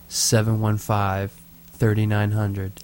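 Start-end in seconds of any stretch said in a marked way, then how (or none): none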